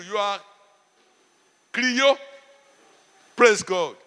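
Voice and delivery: ordinary talk, nothing marked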